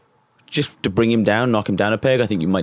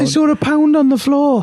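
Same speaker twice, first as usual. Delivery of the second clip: high excited voice; 5 dB louder than usual. Real, not acted. Laughing, telling mishap.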